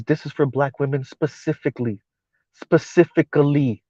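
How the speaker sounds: imitating her soft voice. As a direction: say it softly and primly, stressing specifically. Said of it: "speaks like this and says".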